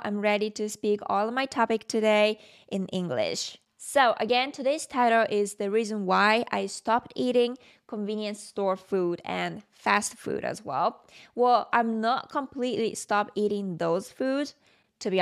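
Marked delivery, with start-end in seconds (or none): none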